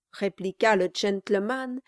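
plain reading voice

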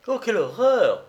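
high, loud cry of horror; horrified exclamation. Acted. Estelle recoiling from her supposed fiancé, horrified cry. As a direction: exclaim in shock. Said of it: "s'écria Estelle".

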